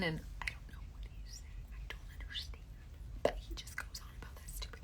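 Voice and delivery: real whispered aside. Real, not acted. Mom confiding about son's game, whispered. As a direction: whisper it like a confession. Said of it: "I don't get it".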